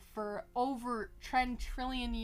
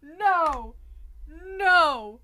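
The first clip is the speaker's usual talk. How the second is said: loud, raised cries of refusal; outraged protest; 5 semitones higher, 9 dB louder, much slower than usual. Real, not acted. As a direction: shout it in exasperated disbelief. Outraged at the game's voice.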